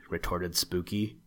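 ordinary delivery, nothing marked

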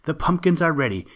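put-on children in the field announcing the pumpkins, excited. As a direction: call it out with glee. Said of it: "they cried".

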